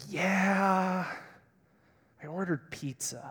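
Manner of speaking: long drawn-out yeah, then dropping soft; imitating a reluctant admission; sheepish confession. Wife admits dinner plans to fasting husband, sheepish.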